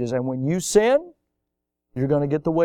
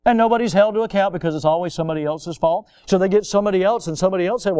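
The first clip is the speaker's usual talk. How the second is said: ordinary delivery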